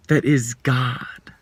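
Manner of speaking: slow whisper, almost no voice; slow reverent whisper; hushed whisper. Real, not acted. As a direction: breathe it out slowly and reverently. Hushed naming of God.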